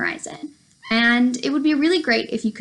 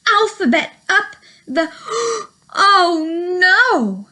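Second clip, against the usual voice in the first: excited storytelling; 7 semitones higher, 5 dB louder, slower than usual. A narrator's reading. Story climax, tree falls.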